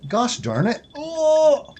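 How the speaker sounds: high, loud gosh darn it; frustrated outburst. Real, not acted. Streamer keeps missing Itto, loud frustrated outburst. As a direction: vent at the missed throw.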